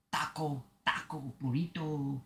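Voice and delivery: quiet practice of a Mexican accent; Mexican accent attempt. Shown as a demonstration. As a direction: try the Mexican accent softly, under your voice. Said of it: "want to do a Mexican accent".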